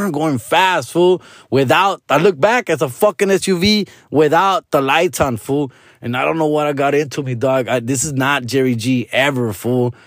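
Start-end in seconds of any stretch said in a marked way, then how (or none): none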